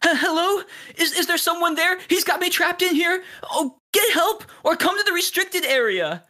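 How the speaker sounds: high, pleading call for help; a scared captive calling out; frightened trapped voice. Acted. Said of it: "you hear a voice say".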